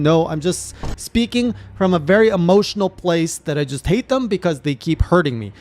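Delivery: ordinary delivery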